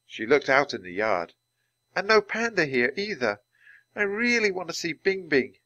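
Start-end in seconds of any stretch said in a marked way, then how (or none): 2.0-5.6 Annie's high hopeful voice; eager girl. Acted. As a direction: chirp it like an excited kid. Girl character voice.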